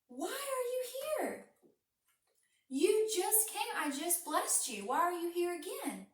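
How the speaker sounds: high, puzzled questioning; baffled father who just blessed a son; a confused old dad, voice jumping up; startled disbelief. Acted.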